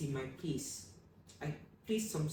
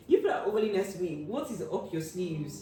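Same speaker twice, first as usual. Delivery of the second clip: suspicious teasing; 3 semitones higher, 7 dB louder than usual. Retold or imitated. Girl teasing classmates about their motives, loud playful suspicion. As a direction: accuse them playfully of scheming.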